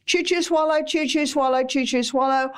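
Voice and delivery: quick chew chew swallow; hurried mock chewing rhythm; gulping it down. Shown as a demonstration. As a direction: rattle it off like wolfing food. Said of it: "until it's almost a cream and then swallow".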